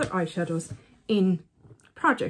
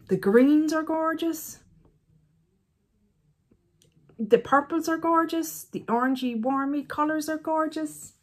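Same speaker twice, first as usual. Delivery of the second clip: put-on voice; 7 semitones higher than usual. Beauty vlogger gushing over the palette in a put-on voice. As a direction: swoon over each colour in a lifted, put-on voice. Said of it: "Why are you saying it in this voice?".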